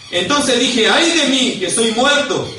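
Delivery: high, loud cry of doom; impassioned shouted quotation; dramatic scripture reading. Acted. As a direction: cry out the lament as the terrified prophet. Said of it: "Mire lo que dice".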